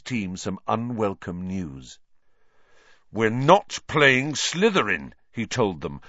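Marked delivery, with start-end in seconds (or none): 3.1-5.1 slow, loud, angry announcement; angry captain, high and deliberate. A narrator's reading. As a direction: break bad news angrily, voice raised. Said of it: "looking very angry".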